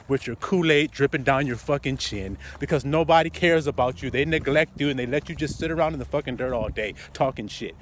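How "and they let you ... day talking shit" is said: ordinary talk, nothing marked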